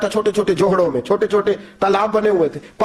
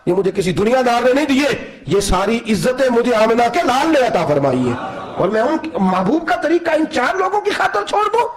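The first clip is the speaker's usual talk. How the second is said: raised, fervent and indignant; majestic wrath; 5 semitones higher than usual. Acted. Umar tells the servant where his honour comes from, in raised indignant tones. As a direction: proclaim it with holy indignation. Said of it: "جلال میں".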